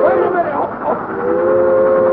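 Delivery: loud, high cries of alarm; terrified shout. Acted. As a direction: shout in terror as disaster hits. Train is upon them.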